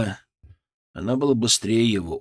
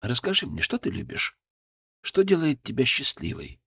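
soft spa whisper; 3 dB quieter than usual. Acted. Comforting crying patient.

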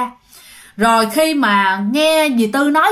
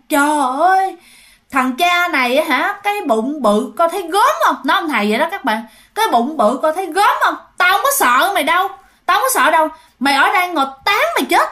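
raised-pitch taunting of an opponent; acted brazen ghost, defiant and higher; mock-fierce scolding from a possessed woman; scornful threat; 4 semitones higher than usual. Possessed aunt taunts and threatens healer.

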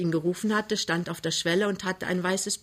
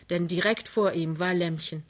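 usual speaking voice

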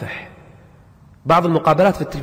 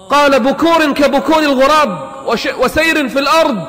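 loud, raised sermon declamation; forceful preaching; 7 semitones higher, 6 dB louder than usual. Real, not acted. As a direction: preach it out at full force, stretching key words.

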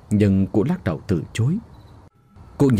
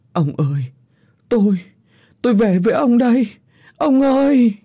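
rising, louder anguished cry in bursts; broken wailing; 12 semitones higher, 4 dB louder than usual. A narrator's reading. Old widow at the river calling her dead husband, broken high wail. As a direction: wail to the dead husband, breaking off between cries. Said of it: "Cụ tủi phận gào lên từng hồi đứt quãng".

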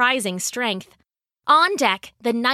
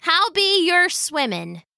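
higher-pitched pirate talk; folksy old sailor sizing up a boy; salty pirate woman; 7 semitones higher than usual. A narrator's reading. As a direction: ask it in pirate dialect.

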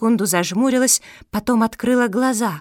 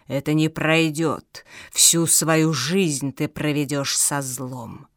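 purring sinister villain; 4 semitones lower than usual. Acted. Villain warns Fenya of eternal servitude, low and sinister. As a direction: draw out the doom in a low voice.

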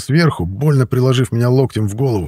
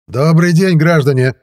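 raised, higher official voice; loud announcement; 6 semitones higher, 4 dB louder, slower than usual. A narrator's reading. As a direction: call out loudly to everyone. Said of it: "Раздался вдруг громкий голос". (громкий голос, официальный оклик)

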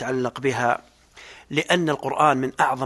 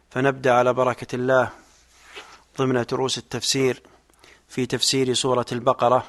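ordinary talk

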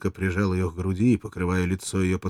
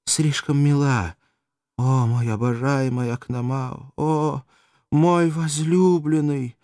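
adoring; 7 semitones higher, 4 dB louder, slower than usual. Acted. Courtesan feigns adoration.

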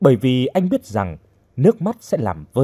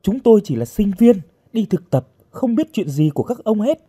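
standing firm; 9 semitones higher than usual. A narrator's reading. Captive student denies knowing the men's business, defiant insistence. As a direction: insist firmly, pitch raised. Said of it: "Hồng nói cứng".

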